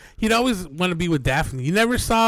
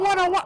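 muffled wah wah talk; 14 semitones higher than usual. Acted. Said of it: "you're wah-wahing me?".

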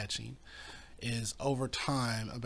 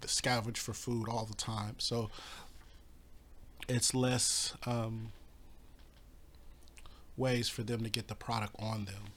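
rough, worn-out voice; talking through a sore throat; hoarse voice; 4 dB quieter than usual. Real, not acted. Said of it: "my voice is really not doing well".

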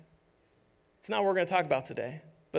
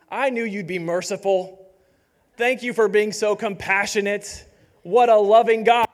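sarcastic thanks, building to a loud shout; mock grateful yell; 8 dB louder than usual. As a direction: yell the thanks with biting irony, louder and louder. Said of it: "Jonah yelling at God".

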